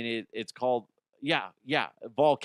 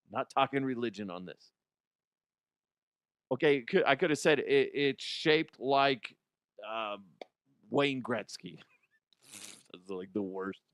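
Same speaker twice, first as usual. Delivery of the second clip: ordinary talk, nothing marked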